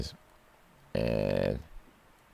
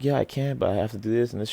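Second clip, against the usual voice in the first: lightly voiced girl, pitched up; a hint of a chatty girl, higher; higher, lightly put-on girl voice; 9 semitones higher, faster than usual. Girl's reply voiced in story.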